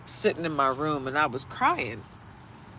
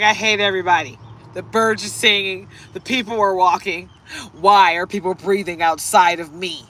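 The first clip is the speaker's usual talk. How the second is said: grumpy dog; 8 dB louder than usual. Retold or imitated. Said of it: "He's so angry".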